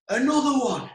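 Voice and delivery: put-on high-pitched drunken call; imitated drunk mate. Drunk mate at the balcony doors, imitated drunken call.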